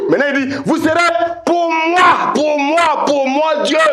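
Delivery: high shouted proclamation; full-force preaching. Real, not acted. Preacher proclaiming that they belong to God, full-force preaching. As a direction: cry POUR MOI out high, over and over.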